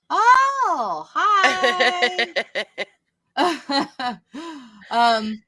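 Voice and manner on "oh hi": high, loud squeal of delight; delighted greeting. Real, not acted. Greeting Josh's friend on recognizing the name, excited squeal. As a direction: greet them with a drawn-out, high-pitched squeal.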